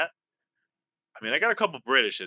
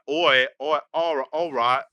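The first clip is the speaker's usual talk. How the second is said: attempted Cockney warm-up; Cockney accent. Player hunting for the Cockney accent, hesitant Cockney. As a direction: try on a halting Cockney. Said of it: "if I could do a Cockney accent".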